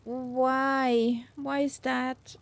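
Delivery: ordinary talk, nothing marked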